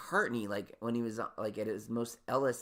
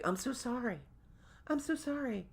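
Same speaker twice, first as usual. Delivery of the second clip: apologetic stylist; 13 semitones higher than usual. Retold or imitated.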